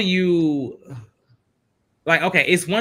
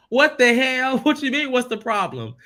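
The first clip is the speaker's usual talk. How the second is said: much higher, louder, laughing in disbelief; 10 semitones higher, 4 dB louder than usual. Retold or imitated. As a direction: cackle through a stunned what the hell. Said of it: "I'm like".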